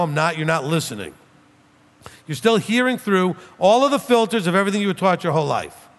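usual speaking voice

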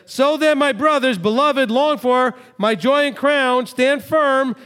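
high, loud appeal, slow on the crown line; fervent preaching quote. Acted. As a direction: urge the brothers to stand firm. Quoting Shaul with fervour.

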